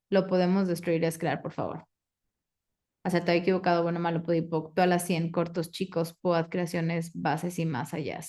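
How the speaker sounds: quick, even ritual recitation, dropping at the end; rote clearing statement. Acted. Facilitator recites the clearing statement to the class, brisk rote chant. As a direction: rattle off the formula by heart.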